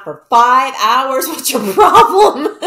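loud, drawn-out mock outrage; hammed-up exasperation breaking into laughter; mock indignant. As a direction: build to a high, laughing confrontation. Acted quote scolding someone who left her unanswered.

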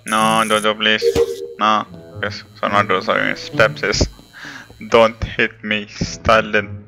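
normal talking voice

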